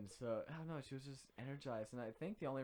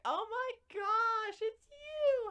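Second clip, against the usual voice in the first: put-on much higher, louder, slow gushing girl voice; bubbly frat girl; 10 dB louder, much slower than usual. Student teacher greets him gushing like a frat girl. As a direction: gush like an excited frat girl. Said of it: "like a frat girl".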